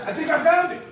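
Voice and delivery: high, loud burst of excitement; excited discovery. Acted. Trainer repeats the pitch to the room, high and excited. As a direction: announce the find with real lift. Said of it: "just through your excitement".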